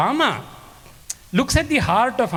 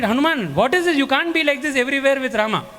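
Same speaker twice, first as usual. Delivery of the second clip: higher, exasperated what is this; exasperated complaint; 7 semitones higher than usual. Retold or imitated. Brothers complaining to Hanuman about always being near Rama, exasperated and higher. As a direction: brothers scolding, half annoyed.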